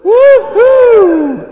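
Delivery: high, loud cheer; cheering woohoo. Shown as a demonstration. Children's talk leader demonstrating a cheer, loud whoop. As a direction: cheer like a teammate took a mark. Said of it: "grown-ups saying".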